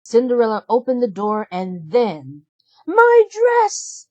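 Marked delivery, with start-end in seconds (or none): 2.8-4.1 high, loud demanding shout. A narrator's reading. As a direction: yell it across the house. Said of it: "shouted Drizella".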